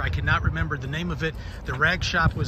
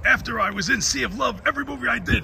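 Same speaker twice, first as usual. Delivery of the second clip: higher, lightly voiced Pacino; Al Pacino impression; 7 semitones higher than usual. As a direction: talk like an aging Pacino. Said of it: "there's some bad Pacinos".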